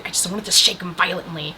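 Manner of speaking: ordinary talk, nothing marked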